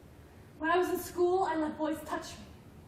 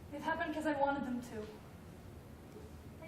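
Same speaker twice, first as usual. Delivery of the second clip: hushed confession; 10 dB quieter, much slower than usual. Acted. Daughter confesses to mother.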